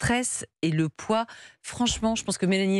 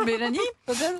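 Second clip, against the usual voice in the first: laughing; 6 semitones higher than usual. Real, not acted. Laughs after mouth-full apology.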